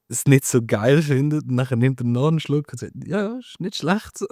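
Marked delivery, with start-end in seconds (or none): 3.1-4.3 imitating Albon. Quoting Albon tasting mate.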